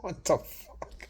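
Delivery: slow, breathless whisper of disbelief; stunned whisper. Retold or imitated. Reacting to being mocked, a slow stunned whisper. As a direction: gasp it out in shock. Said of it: "I go".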